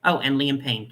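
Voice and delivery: ordinary talk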